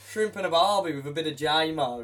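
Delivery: badly faked Aussie catchphrase voice; mock Australian twang for laughs; bad Australian accent. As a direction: ham up a terrible Australian accent. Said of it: "Worst Australian accent ever".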